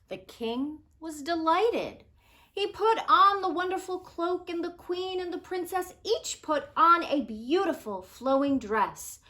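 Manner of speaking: put-on expressive picture-book narration for children; gentle storyteller voice; storybook read-aloud. A narrator's reading. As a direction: tell it like a bedtime story.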